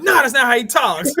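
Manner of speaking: quoted line laughed out high and loud; laughing attorney. Retold or imitated. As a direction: laugh through the correction. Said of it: "one of the attorneys like".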